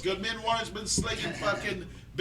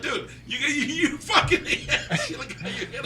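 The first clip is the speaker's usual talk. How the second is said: squeaky laughing disbelief; 8 semitones higher than usual. Retold or imitated. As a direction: laugh through the words. Retold own reaction, laughing.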